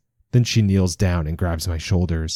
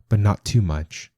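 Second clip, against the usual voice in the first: usual speaking voice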